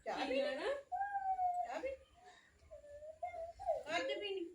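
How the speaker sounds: put-on high, sing-song coaxing to a toddler; baby talk. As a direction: coax the little one gently. Mother coaxing her little child. (ਬੱਚੇ ਨਾਲ ਤੋਤਲੀ ਲਾਡ ਭਰੀ ਬੋਲੀ)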